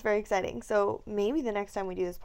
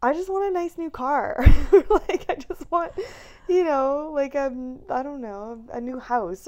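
mock wishful voice; 6 semitones higher, 8 dB louder than usual. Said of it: "be like".

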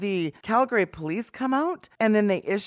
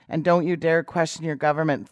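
ordinary delivery

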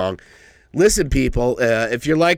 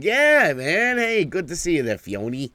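excited greeting; 7 semitones higher than usual. Real, not acted. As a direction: call out a happy hello. Greeting Fiona on stream.

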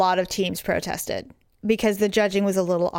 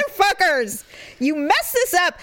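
angry rant; 8 semitones higher than usual. Retold or imitated. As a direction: let the anger out in a mock blast. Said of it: "I was really mad".

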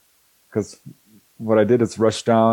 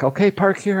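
higher, matter-of-fact order; Wendy directing; 10 semitones higher than usual. Retold or imitated. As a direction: tell them where to park, higher. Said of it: "Wendy's like".

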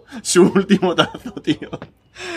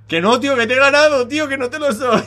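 mocking refusal; 13 semitones higher than usual. Taunting crying kid at door.

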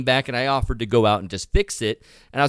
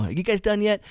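high, thin distant call; retold call, high without real volume; 9 semitones higher than usual. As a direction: call across to the crew, pitched way up. Calling again to distant crew.

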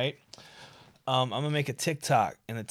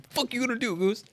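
much higher, mock challenge to a goose; squeaky tough talk; 12 semitones higher, faster than usual. High-pitched goose challenge, re-enacting the run. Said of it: "I used those words".

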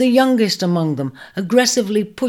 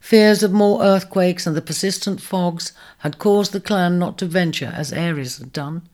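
ordinary delivery

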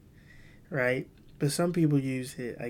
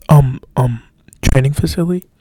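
flustered; 15 dB louder than usual. Retold or imitated. Pastor flustered under lawyer questioning.

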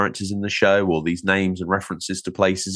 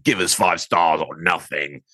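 mock demanding; 3 semitones lower, slower than usual. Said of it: "is me dictating".

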